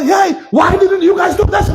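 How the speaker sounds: half-hearted scolding shout; mild mock yell at the staff. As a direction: scold them like a fed-up boss. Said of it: "As ek nou skel".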